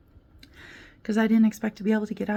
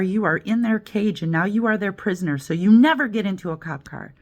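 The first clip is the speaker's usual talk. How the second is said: usual speaking voice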